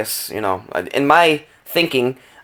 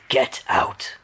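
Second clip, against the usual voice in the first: hushed, raspy imitation of an old spirit; 6 dB quieter than usual. Investigator imitating the ghostly reply caught on the recorder, raspy whisper. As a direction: rasp it softly, almost whispered. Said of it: "Sounded like he had a raspy voice".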